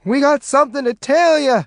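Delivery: high, loud scream. A narrator's reading. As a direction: scream the news.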